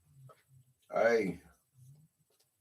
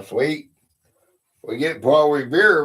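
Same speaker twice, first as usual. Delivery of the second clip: usual speaking voice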